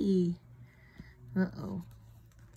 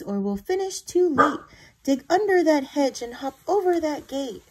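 squeaky urging; 6 semitones higher, 4 dB louder than usual. Acted. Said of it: "Marvin squeaks".

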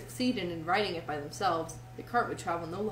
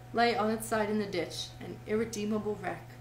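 plain reading voice

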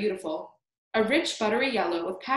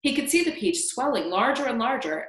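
plain reading voice

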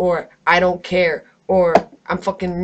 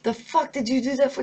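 higher, lightly imitated scolding from a friend; mild mock cussing out; 5 semitones higher than usual. Best friend scolding him after running from police, mock cuss-out. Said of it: "and then cussed me out".

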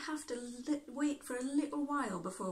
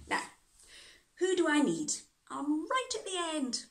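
animated picture-book narration with long pauses; children's story reading; 3 semitones higher, slower than usual. Acted. Reading children a story of an impatient alphabet painter, animated storytelling. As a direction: act out an exasperated Annie for young listeners.

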